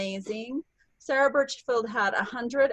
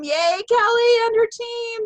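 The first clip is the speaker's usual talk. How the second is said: high, louder cheer; excited cheer; 11 semitones higher, 6 dB louder than usual. Real, not acted. Host cheering Kelly's team on the call, high excited cheer. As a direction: call out her name in celebration.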